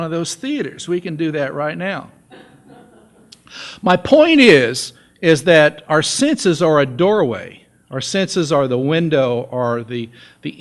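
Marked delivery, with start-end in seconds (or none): 3.8-7.6 slow and loud, driving the point home; emphatic point. Real, not acted. Preacher stresses to church his main point, slow and loud. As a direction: lean hard into the key point.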